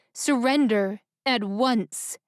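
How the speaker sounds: slow words through clenched teeth. Acted. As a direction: grit it out slowly. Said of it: "spoke through clenched teeth".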